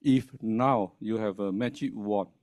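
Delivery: ordinary talk, nothing marked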